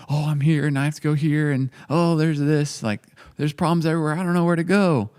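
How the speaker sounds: hammed-up scatterbrained panic; mock frazzled. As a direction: act out being pulled every which way. Acted overwhelm of running two cafes.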